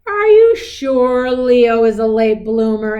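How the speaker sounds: a worried dad pushing for an answer; doubtful father. A narrator's reading. Father doubts the mother about Leo, loud and pressing. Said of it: "asked Leo's father".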